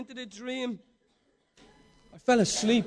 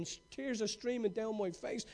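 normal talking voice

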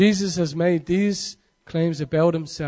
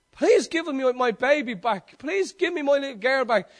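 begging; 7 semitones higher, 4 dB louder than usual. Retold or imitated. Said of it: "I'm on my knees begging him".

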